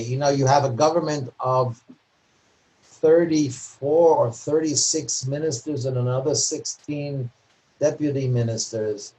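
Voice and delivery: ordinary talk, nothing marked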